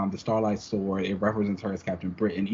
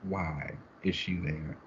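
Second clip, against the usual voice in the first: hushed mock mystery; 4 semitones lower, 8 dB quieter, slower than usual. Dramatic question after the quote.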